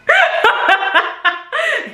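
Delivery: a high burst of laughter; big high laugh at her own story. Real, not acted.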